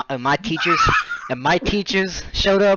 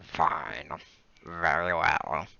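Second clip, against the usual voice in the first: much lower and softer, slow; Snape voice; 11 semitones lower, 11 dB quieter, much slower than usual. Pretend. Snape dismissing students to rooms, low slow murmur. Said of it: "Professor Snape said".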